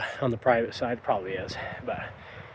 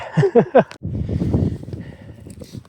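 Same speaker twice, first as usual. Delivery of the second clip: breathless laugh; 10 semitones higher than usual. Real, not acted. After finding the shed antler.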